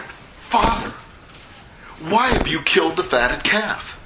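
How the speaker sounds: high-pitched, aggrieved outburst; indignant son. Acted. Older son protests to his father, indignant. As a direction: confront your father in outraged disbelief. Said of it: "I believe he was indignant".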